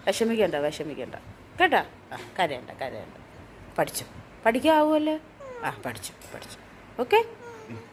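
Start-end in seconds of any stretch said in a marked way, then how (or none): 2.1-4.0 much lower and softer, hushing; soft soothing. Real, not acted. Parent hushing crying daughter softly. 5.6-6.6 hushed whisper. Real, not acted. Parent whispering comfort to daughter. Soothing crying child.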